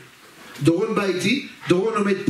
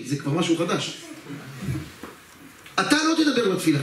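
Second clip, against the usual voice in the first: ordinary delivery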